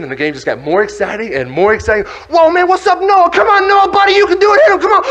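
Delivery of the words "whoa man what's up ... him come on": high, loud cheering at a teammate; excited fan yelling. Acted. Watching the game and cheering Noah on, excited yelling. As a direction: whoop him on at full pitch.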